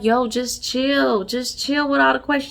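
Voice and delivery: lightly voiced man telling her to chill; mock brush-off. Milton voiced brushing off sister.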